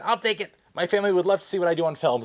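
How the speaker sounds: high, quick acted eagerness; eager volunteering. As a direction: jump in before anyone else. Acts out eagerly grabbing the disc.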